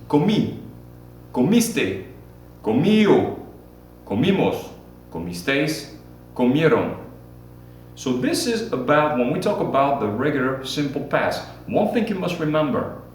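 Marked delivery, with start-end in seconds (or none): 0.1-7.0 Comí, comiste, comió, comimos, comisteis, comieron said clearly one at a time; model for repeating. Shown as a demonstration. Teacher models comer conjugation for class, clear and deliberate. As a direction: say each comer form so students can echo it. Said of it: "Repeat after me".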